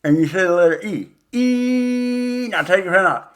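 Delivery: E said around a pen held in the mouth; pen in teeth. Shown as a demonstration. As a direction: say E with a pen between your teeth. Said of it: "you put a pen in your mouth".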